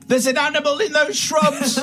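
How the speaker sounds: high, scared London woman; Cockney lady in a fright; London accent. Acted. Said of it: "said in her newly discovered London accent".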